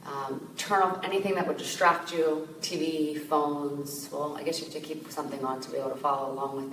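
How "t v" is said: usual speaking voice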